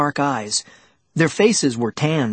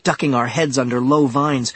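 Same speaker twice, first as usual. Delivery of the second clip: ordinary delivery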